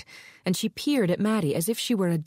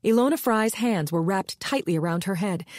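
ordinary delivery

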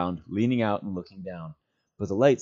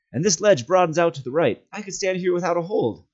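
calling up; 10 semitones higher, 5 dB louder than usual. Acted. Calling up from the ledge.

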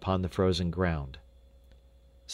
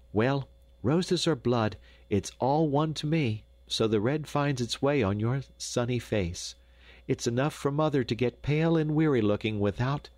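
higher-pitched mother's voice; brisk Dame Brinker; 6 semitones higher than usual. A narrator's reading. Mother settling the children's debate, higher voice. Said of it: "settled the matter with a quick".

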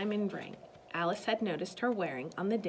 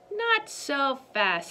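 slow, higher, louder warning; commanding stop; 7 semitones higher, 6 dB louder, much slower than usual. Acted. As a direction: draw it out to halt them. Miss Girk stops the kids leaving.

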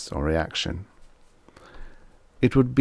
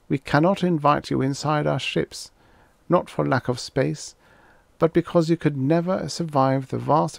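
higher, measured voice of a grave alien warning; alien messenger voice; 7 semitones higher than usual. Acted. Dotty voicing the alien to the Wolvers, higher solemn envoy.

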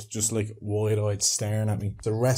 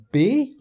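"Bee?" shooting up very high; puzzled question; 12 semitones higher, 9 dB louder than usual. Retold or imitated. Polish woman not understanding stung man, puzzled "Bee?". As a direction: ask "Bee?" loudly, utterly baffled. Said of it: "Looking at me puzzled".

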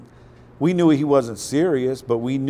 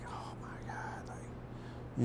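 breathless hushed aside; imitating a whisper; 21 dB quieter, much slower than usual. Mom on the phone reacting to Debbie, hushed whisper. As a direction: whisper it so the caller can't hear.

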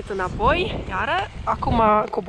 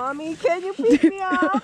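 mock little kid; 6 semitones higher, 4 dB louder than usual. Pretend child asking mommy.

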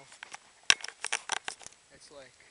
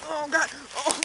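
startled yelp; 8 semitones higher, 9 dB louder than usual. Real, not acted. Falls into snowdrift.

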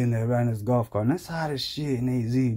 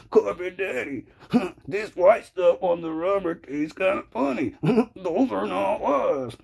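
high, giggly whimper to daddy; fawning conservative; 6 semitones higher than usual. Acted. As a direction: simper submissively in a put-on high voice. Acts submissive conservative licking the cart.

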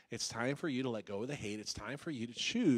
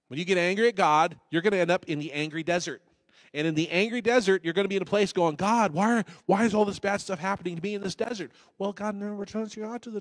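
loud, driving sermon; full-force pulpit preaching; fiery preaching; 6 semitones higher, 10 dB louder than usual. Acted. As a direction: preach it hard at the congregation.

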